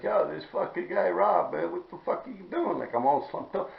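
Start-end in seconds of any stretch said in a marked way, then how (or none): 0.0-2.8 imitating brother. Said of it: "He's like".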